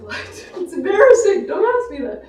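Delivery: high, loud, laughing mock protest; laughing embarrassment. Poet retelling an answer about deadlifting, laughing mock embarrassment. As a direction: laugh through a retold bashful refusal.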